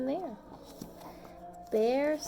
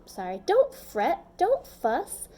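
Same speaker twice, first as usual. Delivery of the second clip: higher, louder soothing squeak; squeaky mouse; 6 semitones higher, 4 dB louder than usual. A narrator's reading. Mouse comforts the crying bear, squeaky and eager. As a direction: soothe him like a little mouse. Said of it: "Mouse squeaks".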